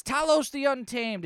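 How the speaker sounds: announced comic name. Acted. Reading a comic panel's title aloud, mock-grand proclamation.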